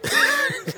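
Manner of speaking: high burst of laughter; short high laugh; real laugh. Real, not acted. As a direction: laugh at the memory. Laughing at own story.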